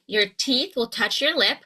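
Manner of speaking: ordinary talk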